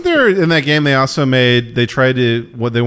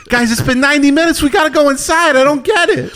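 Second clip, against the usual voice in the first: high-pitched, exasperated pleading; mock frantic bystander; 3 dB louder than usual. Parodying bystanders urging cops inside, high exasperated whine. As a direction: whine like a fed-up onlooker. Mocking bystander at police standoff.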